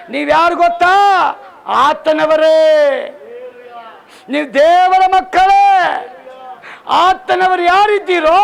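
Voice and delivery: loud, high, ringing sermon cry; full-force revival preaching; fiery preaching. Real, not acted. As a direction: preach it out to the whole hall.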